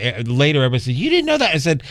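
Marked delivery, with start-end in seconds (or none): 0.9-1.5 high incredulous question; can't believe he didn't know; surprised disbelief. Retold or imitated. Retold friends' disbelief.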